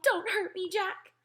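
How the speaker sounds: high-pitched frightened begging; pleading yell. A narrator's reading. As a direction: cry out loudly for mercy. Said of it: "he yelled".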